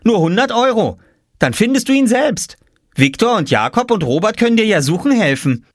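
put-on high, pert child's voice; boy salesman. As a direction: pitch the deal cheekily to your sister. Boy pitches deal to sister. (kecke, hohe Jungenstimme)

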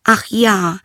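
high, emphatic princess voice; eager princess. Acted. Princess accepts frog's bargain, high eager voice. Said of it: "sagte sie".

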